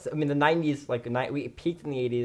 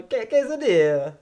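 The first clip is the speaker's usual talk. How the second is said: quesadilla said slowly and higher in a Mexican accent; 10 semitones higher, 7 dB louder than usual. Shown as a demonstration. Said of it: "show them your, uh, Mexican accent that you perfected".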